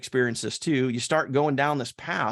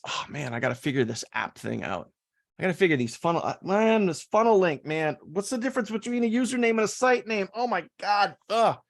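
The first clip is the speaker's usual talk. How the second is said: exasperated; 6 semitones higher than usual. Retold or imitated. Voicing overwhelmed beginner's thoughts.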